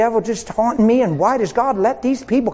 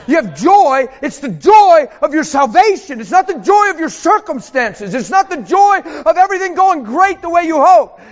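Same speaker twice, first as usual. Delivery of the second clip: rising, louder preaching; preacher building over the word joy; 4 semitones higher, 4 dB louder than usual. Acted. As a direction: drive the point home from the pulpit.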